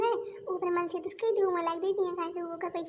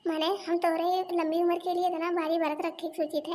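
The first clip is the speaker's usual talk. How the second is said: ordinary talk, nothing marked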